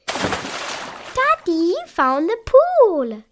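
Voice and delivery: put-on high, loud delighted child call; Peppa calling excitedly. Peppa calling to Daddy about the pool, high excited. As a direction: shout happily across to Daddy. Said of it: "calls Peppa".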